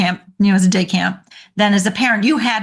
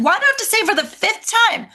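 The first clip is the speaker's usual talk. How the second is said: exasperated parent; 11 semitones higher, faster than usual. Retold or imitated. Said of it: "the parents keep repeating".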